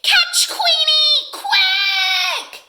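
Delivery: high, slow, blaring duck shout; loud quacking. A narrator's reading. Duck rallies other animals to chase Queenie, loud quack. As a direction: squawk an urgent call, stretching the words. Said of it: "Dottie's loud quacking".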